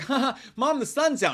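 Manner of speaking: high, put-on girlish lilt; daughter impression. Daughter telling Mom the sun's out, mock-cheery. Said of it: "she's like".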